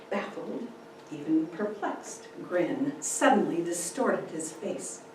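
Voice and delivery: ordinary delivery, nothing marked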